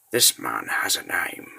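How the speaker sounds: plain reading voice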